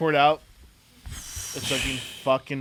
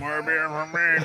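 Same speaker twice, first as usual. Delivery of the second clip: choked Jabba-like mock voice; Jabba the Hutt voice; 2 semitones higher than usual. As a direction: gurgle it out like Jabba. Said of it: "throw your neck back like you're Jabba the Hutt".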